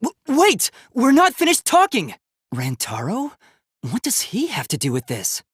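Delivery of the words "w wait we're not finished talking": urgent call. Acted. Robot calling after the departing classmate, urgent shout. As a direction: call after them before they go. Calls after leaving speaker.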